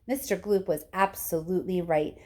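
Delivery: usual speaking voice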